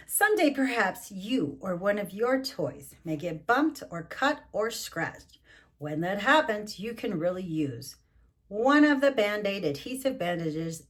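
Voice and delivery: picture-book narration; reading to children. Acted. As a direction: read the back page aloud.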